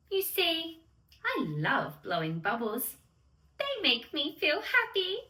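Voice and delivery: usual speaking voice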